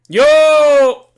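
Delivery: loud, high excited yell; excited shout. Real, not acted. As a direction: cheer it out loud. Excited reaction to figure news.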